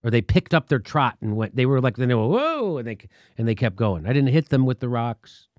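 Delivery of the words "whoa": high startled whoa; a coyote caught off guard. Retold or imitated. As the coyotes flee the thrown rocks, a high startled whoa.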